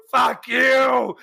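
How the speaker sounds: high, outraged yell; acted angry shout. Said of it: "And he's just".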